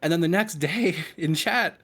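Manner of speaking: usual speaking voice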